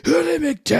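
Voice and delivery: high, loud cartoon demon holler; dumpster demon persona. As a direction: imitate a demon putting on a big act. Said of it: "The dumpster demon has this persona".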